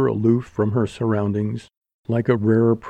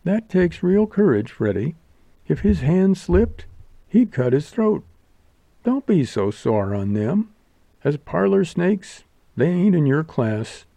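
higher, half-joking about the diner; lightly voiced amused showgirl; 7 semitones higher than usual. A narrator's reading. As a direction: actress teasing Freddie's delicacy.

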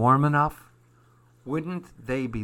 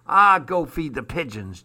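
louder, dismissive jeer; 3 semitones higher, 6 dB louder than usual. A narrator's reading. Armand sending off the fur-coated women, jeering. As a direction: brush them off with a mild jeer. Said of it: "jeered Armand".

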